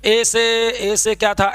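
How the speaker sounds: slow, groping "ए से, ए से क्या था" as if forgetting the list; hesitant, halting recall. Shown as a demonstration. Teacher shows students the wrong way to recite the states, halting and slow. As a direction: stall and search for the next name. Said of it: "इसको ऐसे नहीं बोलेंगे".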